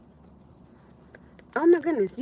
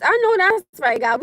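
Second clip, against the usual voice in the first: high, loud "I know that's right"; excited affirmation; 8 semitones higher, 6 dB louder than usual. Real, not acted. Streamer cheering a verse she just read, excited. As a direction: shout your agreement with delight.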